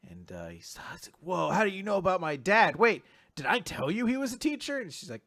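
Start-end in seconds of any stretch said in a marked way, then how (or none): 1.2-4.8 louder, rising mock surprise; startled questioning. Recapper acts the boy startled about his dad, surprised. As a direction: blurt it, caught off guard.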